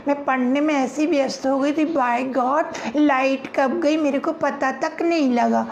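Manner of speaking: put-on lively girlish banter; girls gossiping. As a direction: play teenage friends gossiping.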